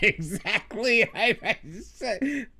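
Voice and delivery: high, drawn-out words through real laughter; laughing. Real, not acted. Streamer agreeing about the hoity-toity accent, laughing. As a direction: laugh helplessly while trying to talk.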